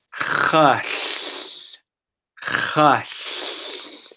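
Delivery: "Hush" as a long voiceless rush of air; breathy demo sound. Shown as a demonstration. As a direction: model "Hush" as pure breath with no voice. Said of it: "We're just gonna say these three letters".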